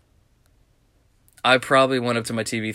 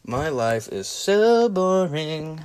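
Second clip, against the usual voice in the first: drawn-out complaint; 5 semitones higher, much slower than usual. Pretend. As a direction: stretch every word, higher pitched. Repeats own line, drawn out.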